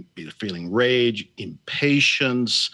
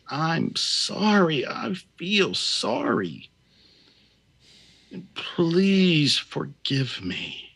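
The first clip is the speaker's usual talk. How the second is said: higher, slow and heartfelt pleading; with deep feeling; 5 semitones higher, much slower than usual. Shown as a demonstration. Healer showing how to feel the phrases, slow heartfelt plea. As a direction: ache through each phrase as you say it. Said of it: "say it with feeling".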